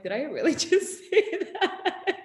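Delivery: laughing disbelief. Retold or imitated. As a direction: giggle at your own confession.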